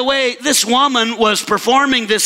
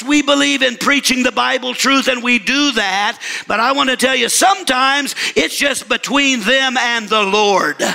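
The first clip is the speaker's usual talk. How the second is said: usual speaking voice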